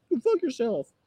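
very high squeaky mock insult; mock fleeing heckler. Passer-by running away from activist, squeaky mock insult. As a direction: throw it out in a high falsetto. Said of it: "if they're just running away saying, like".

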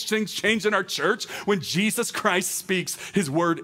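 high, driving repeated refrain; impassioned preaching. Real, not acted. Preacher telling congregation that Jesus's word changes things, impassioned. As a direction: hammer each phrase, pitched well up.

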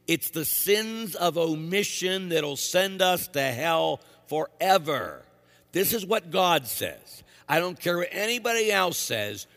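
full-force preacher; fiery preaching. Acted. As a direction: hammer the point home.

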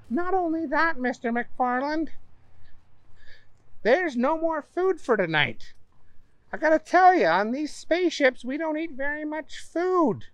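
high, polite hostess voice; Donna's voice. Acted. Donna explains rules.

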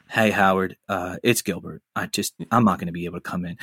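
a quiet, deflated call-in, no comic edge; Gilbert Gottfried's real voice. Retold or imitated. Gilbert calling Howard in sick, low and subdued. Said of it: "And it's his real voice".

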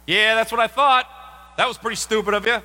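loud, fast, jeering told-you-so; smug taunt. Pretend. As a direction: rub it in as they pass. Taunting someone pulled over.

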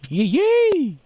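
high, loud little yay; excited cheer. Acted. Cheering for farmer listeners.

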